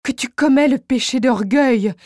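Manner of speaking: damn the science that steals him; despairing curse. Acted. Wife curses his science in despair.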